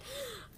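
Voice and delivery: sharp mock gasp of shock; a quick, high, breathy gasp; retold gasp. Recalling sticky-noted favorite parts, a sharp retold gasp. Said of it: "I was like".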